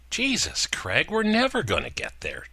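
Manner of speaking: put-on high, impatient girlfriend voice; Gina, whiny girlfriend. A narrator's reading. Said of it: "Gina said".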